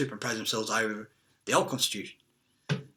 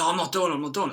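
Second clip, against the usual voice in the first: higher-pitched mock protest; quick, raised-pitch mimicked refusal; retold refusal; 5 semitones higher, 3 dB louder, much faster than usual. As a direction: imitate someone digging their heels in.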